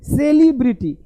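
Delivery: "सेलिब्रिटी" as SAY-lee-bri-tee; wrong pronunciation. Shown as a demonstration. Teacher shows students the common error, wrong pronunciation. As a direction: stretch the first vowels of "सेलिब्रिटी" into long say-lee. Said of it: "ये गलत है".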